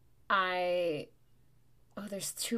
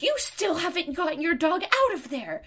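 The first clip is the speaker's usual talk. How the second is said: high, loud mock screeching rant; shrieky mock tirade; imitated yelling; 13 semitones higher, 9 dB louder than usual. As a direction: yell it as a hammed-up angry stranger, pitch way up. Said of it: "you can't stop yelling at me".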